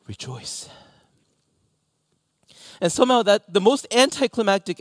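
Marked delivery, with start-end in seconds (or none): 0.1-0.7 whispered rejoice. Retold or imitated. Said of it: "only he can say".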